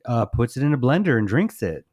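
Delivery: normal talking voice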